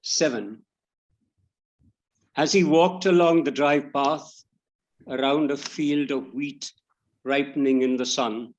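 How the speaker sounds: measured poetry reading with pauses between phrases; poem recitation. Acted. Poet reading an elegy for a great writer, measured recitation. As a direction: read it line by line, letting each phrase settle.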